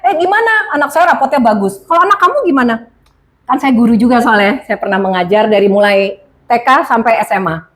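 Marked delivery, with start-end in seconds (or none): 0.1-2.7 bragging parent. Retold or imitated.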